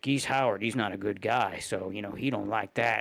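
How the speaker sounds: lazy mock YouTuber voiceover; insincere YouTuber parody. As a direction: drone it out like you're reading bullet points. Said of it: "insincere millennial race".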